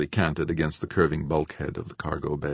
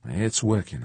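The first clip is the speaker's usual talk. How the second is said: restrained, less excited confirmation; conceding; 4 semitones higher than usual. A narrator's reading. George admitting the laser works, restrained. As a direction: concede it, settling down.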